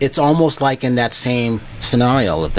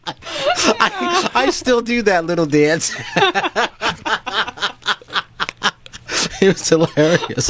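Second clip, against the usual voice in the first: laughing; 6 semitones higher, slower than usual. Real, not acted. Laughing while recalling the dance.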